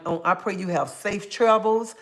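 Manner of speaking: ordinary talk, nothing marked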